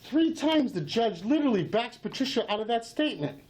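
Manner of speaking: ordinary delivery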